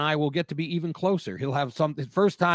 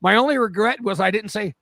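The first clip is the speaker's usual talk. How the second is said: ordinary talk, nothing marked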